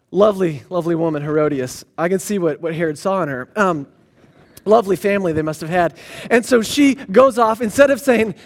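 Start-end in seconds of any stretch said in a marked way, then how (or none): 0.1-5.9 low, deadpan aside; dry sarcasm. Real, not acted. Preacher remarking on Herodias and her family, drily sarcastic. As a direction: drop the voice for mock admiration.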